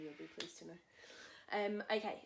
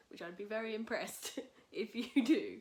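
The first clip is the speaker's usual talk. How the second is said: talking through laughter, higher and louder; amused, laughter spilling into the speech; laughing; 5 semitones higher, 9 dB louder than usual. Real, not acted. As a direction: laugh your way through the words. Joking that viewers might remember.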